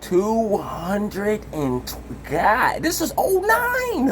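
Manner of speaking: stunned disbelief. Real, not acted. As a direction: gasp at the huge number in disbelief. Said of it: "reacts to number".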